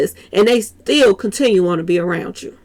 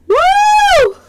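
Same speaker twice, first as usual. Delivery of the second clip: shocked whoop; 5 dB louder than usual. Real, not acted. Reacting to a quote in the clip.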